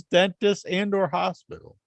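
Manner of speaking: ordinary delivery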